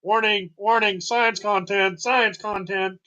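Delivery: slow, loud high warning siren voice; robot alarm. Pretend. Mock alarm announcement.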